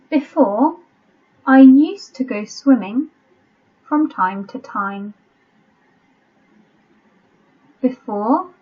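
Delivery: careful reading, leaving gaps for writing; teacher's deliberate dictation voice; slow dictation. Shown as a demonstration. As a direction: dictate phrase by phrase with pauses. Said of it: "I'll read it slowly".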